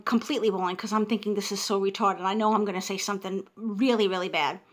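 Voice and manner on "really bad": normal talking voice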